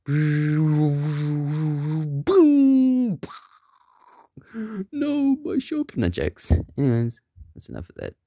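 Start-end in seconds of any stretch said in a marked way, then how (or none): 0.0-3.2 long vocal gong noise rising higher; high, held crash sound effect; imitated gong boom. Imitates gong and singing bowl. 4.9-6.0 very high, slow lament; hammed-up high-pitched cry of dismay; mock wail. Character laments destroyed shop.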